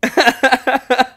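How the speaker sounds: high, loud burst of laughter; bright real laughing. Real, not acted. Laughing at memory.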